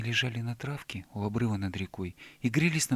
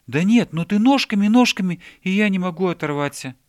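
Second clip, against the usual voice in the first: put-on high, loud, childlike enthusiasm; raised, animated chatter; excited explaining; 7 semitones higher, 10 dB louder than usual. A narrator's reading. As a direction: insist with delight. Bear excitedly explains the funny running.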